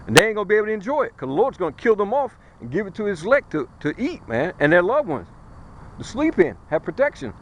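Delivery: usual speaking voice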